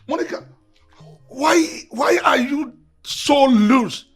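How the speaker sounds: high, loud, outraged accusation; jealous tirade. Acted. Husband accusing his wife over the errand, jealous tirade.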